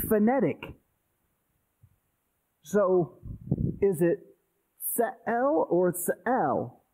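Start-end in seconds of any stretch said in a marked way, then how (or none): 5.0-6.8 Se'el then Se'el, slowly, testing which syllable takes the accent; trying two stresses. Shown as a demonstration. Teacher asking the class where the accent falls in Se'el, slow. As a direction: sound out Se'el twice, slowly, weighing the stress. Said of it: "Where's the accent?".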